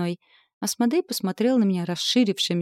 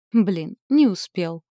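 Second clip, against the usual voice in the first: sighing; 5 dB louder, slower than usual. A narrator's reading. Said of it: "вздохнул Асмодей".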